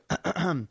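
short harsh throat clear. Shown as a demonstration. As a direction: clear your throat sharply, like a nagging drip. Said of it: "and I'm constantly going".

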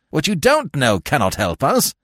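high, irritated retort; demanding. A narrator's reading. Investigator dismissing the warder's excuses, irritably demanding. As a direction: cut through his excuses sharply. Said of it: "he demanded".

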